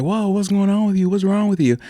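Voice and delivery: startled guy. Retold or imitated. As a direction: react as if thrown off guard. Guy reacts to being kicked out.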